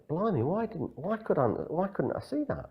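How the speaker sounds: high-pitched, amazed self-questioning; eureka moment. Acted. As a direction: act out astonishment at what was missed. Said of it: "It's like a eureka moment".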